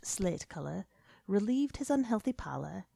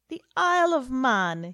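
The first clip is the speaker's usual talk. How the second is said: scoffing; 7 semitones higher, 7 dB louder than usual. A narrator's reading. Mocking laugh at Chandler.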